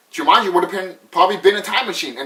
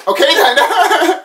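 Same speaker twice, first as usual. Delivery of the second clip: high, slow, laughing through the words; 11 semitones higher, 5 dB louder, much slower than usual. Real, not acted. Amused at the silly movie rule, laughing. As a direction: laugh it out, drawn out.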